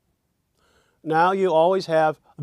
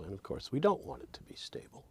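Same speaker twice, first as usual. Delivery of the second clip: whisper; 13 dB quieter, faster than usual. Real, not acted. Aside after playful voice.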